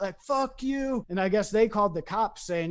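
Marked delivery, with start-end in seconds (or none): 0.2-1.1 high, drawn-out imitated yell, held back in volume; retold spitting curse. Drunk walker at a passing car, imitated angry yell. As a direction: mimic hurling a curse after someone. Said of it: "spitting at it".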